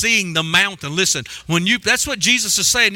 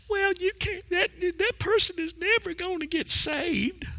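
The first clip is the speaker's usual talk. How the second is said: high, thin taunting voice of the devil; the devil mocking the believer, high and thin; mocking Satan voice; 9 semitones higher, 8 dB quieter than usual. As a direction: sneer it like the devil whispering doubts.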